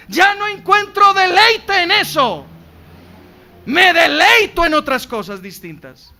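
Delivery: preaching at full force. Acted. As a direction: drive it home to the congregation. (predicación a todo pulmón)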